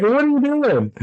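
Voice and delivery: sudden high alarmed cry; dad catching his child about to hit his own thumb. Retold or imitated. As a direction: stop the kid before he smashes his thumb. Dad grabs wrist mid-hammer.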